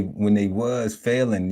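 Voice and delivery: normal talking voice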